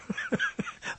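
short breathy chuckle; amused chuckle. Real, not acted. Host correcting himself on the hour, soft chuckle. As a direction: chuckle softly to yourself.

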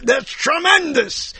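high, drawn-out "tremendous"; mock amazement. Comic imitating how New York used the word, mock amazement. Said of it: "Everything was, tr".

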